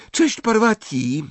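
high, bright-sounding hail; called-out greeting. A narrator's reading. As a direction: call hello to a friend. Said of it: "zawołała Hermiona".

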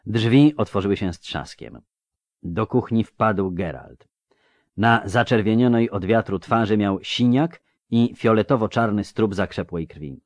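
usual speaking voice

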